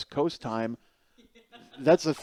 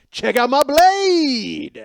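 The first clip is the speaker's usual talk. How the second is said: high, very loud, drawn-out yell; show-off battle cry; 13 semitones higher, 12 dB louder, much slower than usual. Retold or imitated. Eleven-year-old bursts into Dunkin' Donuts, bragging yell. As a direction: stretch the last word into a holler. Boy bursts into shop showing knife.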